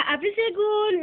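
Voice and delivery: high, loud cheery goodbye; loud doll farewell. Pretend. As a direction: call out the goodbye bright and high. Playful doll farewell shout.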